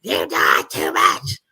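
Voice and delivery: very low, slow creaky mimicry; old lady's scolding, imitated low and slow; mimicked old lady. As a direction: drag it out low, mocking her complaint. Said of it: "She's like".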